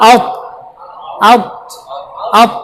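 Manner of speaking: "আউত" repeated with heavy rounded ta; rounded mouth. Shown as a demonstration. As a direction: drill "আউত" with round lips and an air-filled mouth. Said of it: "মুখ গোল হবে". (মুখ গোল করে ভারী "আউত")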